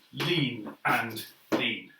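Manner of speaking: very slow drawn-out lean and lean; slow call. Shown as a demonstration. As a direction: stretch each lean out slowly. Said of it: "So the whole thing will go".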